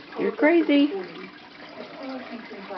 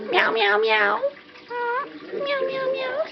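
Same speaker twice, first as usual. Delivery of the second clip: imitated meows; 9 semitones higher than usual. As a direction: meow for the bird to copy. Teaching the bird to meow.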